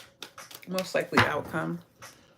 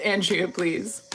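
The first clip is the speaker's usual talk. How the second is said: ordinary talk, nothing marked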